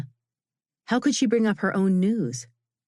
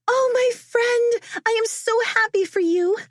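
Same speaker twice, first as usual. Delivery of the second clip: overjoyed friend; 3 dB louder than usual. A narrator's reading. Friend squeals over engagement news.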